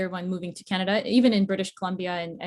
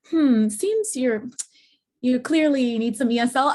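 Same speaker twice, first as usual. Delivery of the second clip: higher, louder imitation of a judging professor; mock professor; 6 semitones higher, 6 dB louder than usual. University professor judging her student's paper, higher and louder. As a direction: put on the professor's voice, a little pleased with herself. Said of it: "And she tells me".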